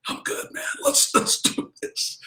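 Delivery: breathy, voiceless, winded; out of breath. Retold or imitated. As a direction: gasp it out, pretending you're fine. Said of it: "and I'm winded".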